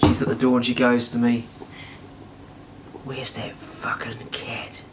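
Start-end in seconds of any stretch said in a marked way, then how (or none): 3.0-4.8 slow, very soft, whispered threat; menacing whisper. Retold or imitated. Girlfriend at the door demands the cat, menacing whisper.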